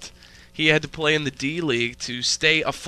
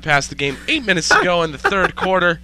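sportscaster update; 5 dB louder than usual. Acted. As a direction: announce the score like a TV anchor. Mock play-by-play update.

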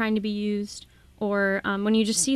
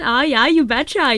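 a louder, higher Minnesota accent on "you betcha"; Minnesotan accent; 4 semitones higher, 9 dB louder than usual. Shown as a demonstration. Guest asked by the hosts to show her Minnesota accent. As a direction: lean into a folksy Minnesota accent. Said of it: "a Minnesotan accent".

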